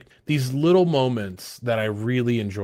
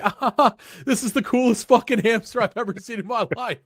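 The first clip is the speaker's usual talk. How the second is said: gushing laugh; 10 semitones higher than usual. Retold or imitated. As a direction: squeal it out, laughing at how cute it is. Mock gushing over cute hamster.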